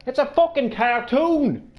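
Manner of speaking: loud, high outraged yelling; exasperated shouting at critics; angry rant. Real, not acted. Ranting outrage over Apu.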